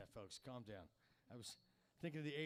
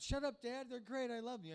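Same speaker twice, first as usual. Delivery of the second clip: put-on much higher, pushy kid voice; bratty teen; 8 semitones higher, 3 dB louder than usual. Teen telling dad the Beatles are great, high and bratty. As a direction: snap back at dad like a teenager.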